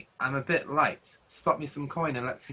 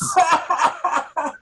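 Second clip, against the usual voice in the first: big high belly laugh; hearty soldier's guffaw; loud guffaw; 9 dB louder than usual. Acted. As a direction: roar with laughter at the joke. Guard laughs at joke.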